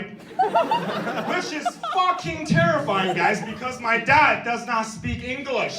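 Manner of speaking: high, drawn-out yell of mock terror; horrified yelling. Comic telling the crowd dad speaks no English, horrified yell. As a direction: shout it slowly in exaggerated disbelief.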